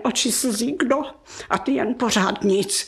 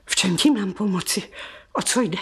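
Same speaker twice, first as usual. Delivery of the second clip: ordinary delivery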